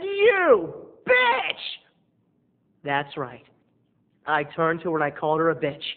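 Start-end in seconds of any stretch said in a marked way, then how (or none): 0.0-1.8 yelled, much higher and louder; explosive insult. Retold or imitated. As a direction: let it burst out from the belly. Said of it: "It started in my belly".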